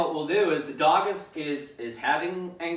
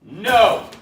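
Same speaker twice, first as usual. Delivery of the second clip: sharp, much louder "No" pushed out on the breath; correction on the exhale; 5 semitones higher, 11 dB louder than usual. Shown as a demonstration. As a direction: breathe in, then release one firm word. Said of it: "Breathe in".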